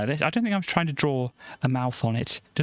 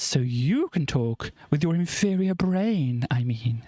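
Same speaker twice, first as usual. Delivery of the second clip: sarcastic alien; 2 semitones higher, slower than usual. Acted. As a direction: talk down to the human. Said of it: "And then Fly says".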